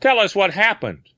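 high, loud order; an old captain's loud demand; commanding. A narrator's reading. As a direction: command him to talk. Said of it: "he commanded".

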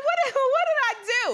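very high, rapid stammered question; mock-innocent high flirt voice; playing innocent. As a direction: plead cluelessness in a rushed squeak. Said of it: "I said".

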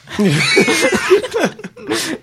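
loud high-pitched laugh bursting out; 1 high burst of laughter. Real, not acted. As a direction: crack up loudly. Big laugh after joke.

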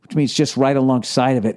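usual speaking voice

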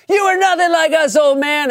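high, loud jeering shout; kids yelling back. Acted. Kids taunting an old neighbor, high mock yell. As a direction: yell it like a pack of bratty kids. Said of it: "the kids yell back".

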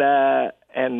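ordinary talk

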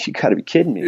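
half-laughing at the absurd ask; incredulous. Retold or imitated. Recalling praying for her teeth, mock disbelief. Retelling disbelief at the girl's request.

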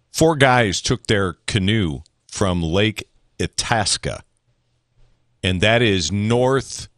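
ordinary delivery

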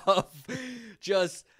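breaks into a laugh mid-phrase; laughing while teasing a friend about his age. Real, not acted.

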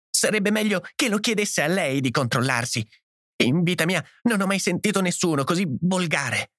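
high-pitched, upset retort; broken-voiced outrage. A narrator's reading. Edith answering the host's rebuke, broken-voiced outrage. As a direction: throw it back, close to tears. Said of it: "esclamò Edith con la voce rotta".

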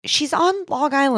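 high-pitched, fed-up objection; exasperated protest. Real, not acted. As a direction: answer for the victim in frustration. Host protests at repeated question.

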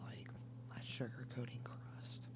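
hushed, breathy whisper. Real, not acted. Tipsy woman whispering about her salmon recipe, hushed. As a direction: whisper it like a delicious secret.

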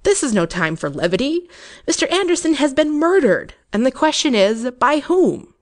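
rising indignant official; stern rebuke. Acted.

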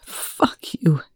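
whispered through tears; tearful whisper. A narrator's reading. Eli whispering at Young through tears. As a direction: breathe it out, close to crying. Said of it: "just before a tear landed on it".

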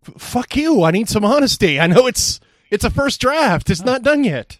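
high, loud exasperated rant; mock frustrated outburst. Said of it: "And I'm like".

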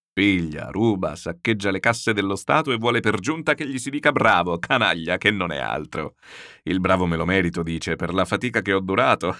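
hearty general scoffing at rogues; blustering general, amused. Acted. General mocking the officials who want praise, amused bluster. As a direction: bluster through it, then burst into laughter.

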